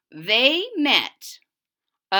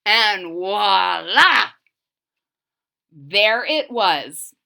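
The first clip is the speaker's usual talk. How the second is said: slow reveal; 4 dB louder, much slower than usual. A narrator's reading. Wizard finds pie.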